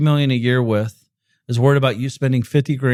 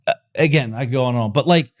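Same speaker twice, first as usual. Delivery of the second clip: ordinary talk, nothing marked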